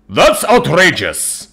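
loud, high, slow shout of outrage; outraged yell. A narrator's reading. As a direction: yell it in indignation, stretching the words. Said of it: "I yell at the little Xeno woman".

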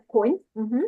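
usual speaking voice

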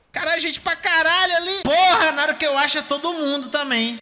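high, loud alarmed outburst; alarmed exclaim. Real, not acted. Gamer alarmed to find a crowd of enemies. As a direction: blurt it out as enemies swarm. Sees many enemies at once.

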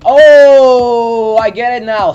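loud, high, drawn-out "oh"; big dawning-on-you exclamation; sudden realization. Real, not acted. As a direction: let the penny drop loudly.

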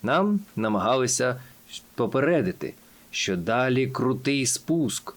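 ordinary delivery, nothing marked

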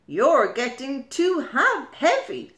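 loud exasperated complaint; fed-up crab. Acted. Crab complains to the anemone, fed up. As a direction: grumble at the heavy load. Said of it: "says Crab".

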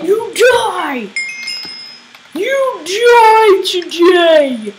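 loud, high, drawn-out mock-menacing cry; battle threat. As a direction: declare doom on the game opponent. Threatening opponent in game.

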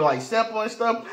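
ordinary talk, nothing marked

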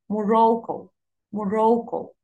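mo-RO-cco, موروكو with a strong middle; موروكو falling after the stressed middle; middle-syllable stress. Shown as a demonstration. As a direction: lean hard on "ro" in موروكو. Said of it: "الاسترس على المقطع اللي في النص".

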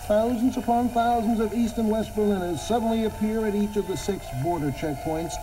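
normal talking voice

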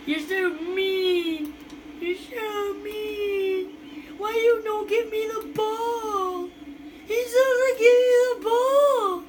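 high, slow, drawn-out wail; mock crying whine. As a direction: whimper like a wronged little kid. Whining at player withholding ball.

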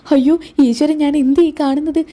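high, startled mother's cry of alarm; shocked cry. Acted. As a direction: gasp it out in shock at the sight. Mother shocked seeing daughter hit. (ഞെട്ടലോടെ ഉയർന്ന നിലവിളി)

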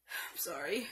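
soft whispered apology; whispering. Real, not acted. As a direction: whisper it under your breath. Embarrassed aside to camera.

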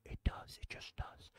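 unvoiced hushed aside; whisper. Real, not acted. Vlogger confiding about 4K streaming, whispered. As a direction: whisper it like a secret.

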